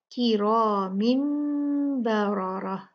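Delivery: slow recitation, heavy full ra; recite with heavy ra. Shown as a demonstration. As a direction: recite किरामिन बरारह with heavy ra. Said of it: "ये सब भारी पढ़ी जाएंगी".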